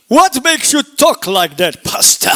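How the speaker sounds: high, loud, slow skeptical voice; mock questioner. Pastor voicing a doubter questioning him, high and loud. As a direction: play a doubting churchgoer. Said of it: "Do you have about two hours".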